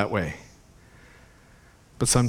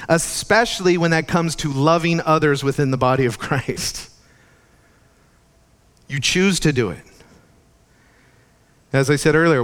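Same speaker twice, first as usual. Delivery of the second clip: higher, louder impassioned sermon; fervent preaching; 5 semitones higher, 3 dB louder than usual. Acted. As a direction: preach it with rising fervor.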